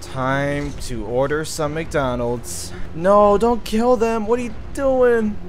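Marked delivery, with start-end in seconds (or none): 2.9-5.4 much higher, louder frantic yell; frantic shout. Real, not acted. Gamer yells at teammates shooting the staff, frantic shout. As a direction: stop them before they ruin the order. Teammates attack the drive-thru.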